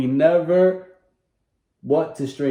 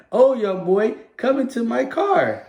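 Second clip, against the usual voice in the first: higher, coaxing pretend stranger; mock stranger luring; 8 semitones higher than usual. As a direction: lure the kid into the car, hammed-up. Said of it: "I'm the stranger".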